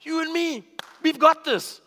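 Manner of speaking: loud, high hype call to battle; rally him like a gym buddy; shouting come on dude, high and loud; pumped-up buddy. Pretend. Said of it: "I would be like".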